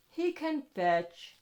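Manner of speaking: usual speaking voice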